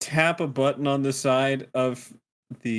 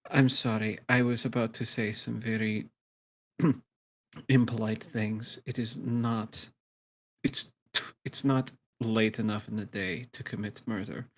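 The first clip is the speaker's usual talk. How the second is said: low, quiet, measured menace; restrained threat; 4 semitones lower, 5 dB quieter than usual. Acted. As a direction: hold back your temper, speaking low. Hungover character restrains murderous annoyance.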